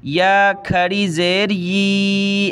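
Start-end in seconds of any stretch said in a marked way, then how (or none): 1.5-2.5 "यी" held for one measured long vowel; elongated ee. Shown as a demonstration. Teacher showing the alif length, "यी" drawn out. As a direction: stretch the ee in "यी" on a level note. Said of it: "नीचे खींचेंगे".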